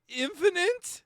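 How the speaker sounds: high, rising mock-frightened squeak; mock scared gasp. Streamer mimicking a spooked Sonic, mock fearful. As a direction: imitate Sonic's scared little question. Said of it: "Sonic sounds scared as hell".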